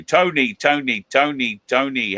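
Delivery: high, loud repeated call. Real, not acted. Host hailing a viewer in chat, high and loud. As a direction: call his name across the room.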